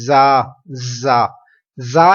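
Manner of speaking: زا with a strong, hissing whistle on the z; whistling z. Shown as a demonstration. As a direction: hold a sharp buzzing z in زا, loud. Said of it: "آپ تھوڑی سی سیٹی کی آواز نکالیں گے".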